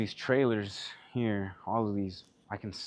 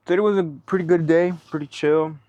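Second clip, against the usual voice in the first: energetic outro; 7 semitones higher, 10 dB louder than usual. Real, not acted. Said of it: "restarts outro after mic fell".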